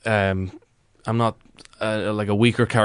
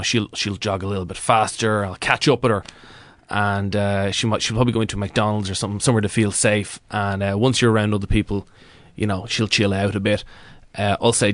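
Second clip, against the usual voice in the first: normal talking voice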